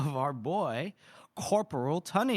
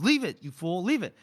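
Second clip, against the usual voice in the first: mock snap, higher on the repeat; snapping; 4 semitones higher, 4 dB louder than usual. Tunney rushing back toward the soldier after his boot, snapping. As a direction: imitate an exasperated corporal ordering him off. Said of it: "like, snaps".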